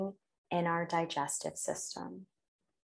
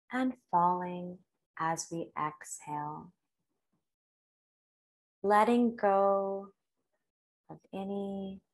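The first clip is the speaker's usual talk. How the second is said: guided meditation. Real, not acted. Leads a breathing meditation.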